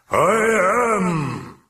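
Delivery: high, loud roar in a monstrous voice; demonic bellow. A narrator's reading. Possessed Regan roaring at the priest, enraged bellow. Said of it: "Regan bellowed with a sudden jerk forward".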